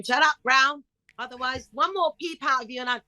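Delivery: angry teacher. Retold or imitated. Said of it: "teacher scolding her in class".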